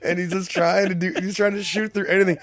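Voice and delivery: very high, loud, near-crying with laughter; helpless laughter. Real, not acted. Telling a friend about horrible radio jokes, helplessly laughing. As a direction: squeal it out while cracking up.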